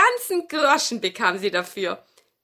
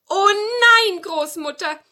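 high witch voice, falling; witch protesting; 10 semitones higher, 8 dB louder than usual. Acted. Witch denies to grandmother that she is wicked, high protest. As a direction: protest high, then drop. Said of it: "sagte die Hexe Tannenmütterchen".

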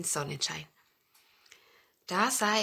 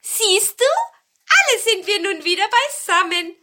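high, loud cheerful call; little cones calling; 12 semitones higher, 9 dB louder than usual. A narrator's reading. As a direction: call out in a piping voice. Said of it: "und jetzt riefen sie". (hohes, lautes Rufen)

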